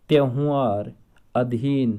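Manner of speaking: usual speaking voice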